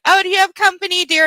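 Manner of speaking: high, sweet-old-lady impression; imitated grandma. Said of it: "and she's like".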